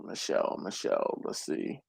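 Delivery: whispered muttering to self; reading names under his breath. Real, not acted. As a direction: whisper the name while scrolling. Searching messages under breath.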